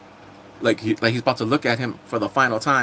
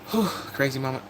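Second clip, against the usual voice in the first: usual speaking voice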